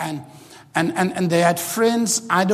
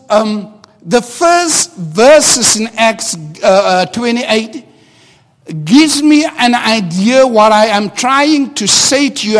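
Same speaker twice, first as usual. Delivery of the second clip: raised, louder preaching voice, driving each point home; full-force preaching; 6 semitones higher, 11 dB louder than usual. Acted. Pastor urging the congregation to shake their past, full-force preaching. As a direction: preach it out with fervour to the whole church.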